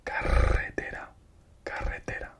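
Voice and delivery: carretera with the rr rolled, whispered; trilled double R. Shown as a demonstration. Teacher showing double R in carretera, rolled whisper. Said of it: "If it's double R".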